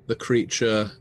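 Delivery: ordinary delivery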